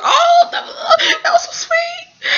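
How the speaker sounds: very high, melting "aww" voice; gushing squeal. Retold or imitated. Viewer gushing over him staying by her side, high squeal. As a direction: squeal it as if overcome by sweetness.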